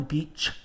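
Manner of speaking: "beach" with the final CH clearly released; sibilant CH. Shown as a demonstration. Teacher showing students the CH sound, sibilant "beach". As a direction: let the CH in "beach" hiss out. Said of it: "the C H is a sibilant sound".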